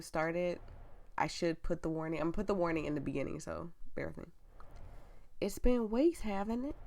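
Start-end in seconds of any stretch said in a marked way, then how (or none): 5.4-6.8 higher-pitched country nurse twang; country accent. Acted. Nurse asking the doctor about the weeks passed, country accent. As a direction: ask it in a put-on country accent. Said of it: "that I'm butchering".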